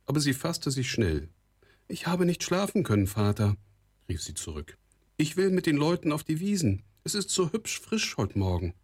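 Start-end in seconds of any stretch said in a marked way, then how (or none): 1.9-3.6 put-on much higher girl's voice; light, high-pitched reply to her father; young daughter. A narrator's reading. 5.2-8.7 put-on high girl's voice, pleading her case; higher-pitched girl talking to her father; young daughter. A narrator's reading.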